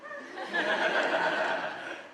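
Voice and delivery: laugh. Real, not acted. Audience-facing opening laugh.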